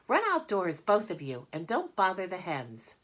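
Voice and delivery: loud high scolding aunt; shooing order. Acted. Aunt shooing the children out of her kitchen, loud and high. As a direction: chase the kids outside firmly.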